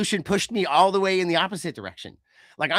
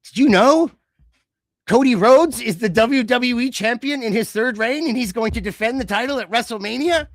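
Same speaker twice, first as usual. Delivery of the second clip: loud, higher hammed-up reveal; loud mock announcer; 5 semitones higher, 7 dB louder than usual. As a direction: announce it like outraged breaking news. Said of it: "it says on the screen".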